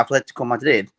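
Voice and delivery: lightly Spanish pronunciation of the club name; touch of Spanish accent. Real, not acted. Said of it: "say it in a Spanish accent".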